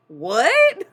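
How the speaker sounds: loud, high incredulous what; boss's incredulous shout. Retold or imitated. Boss can't believe she didn't know, loud. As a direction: bark it back in disbelief. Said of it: "He's like".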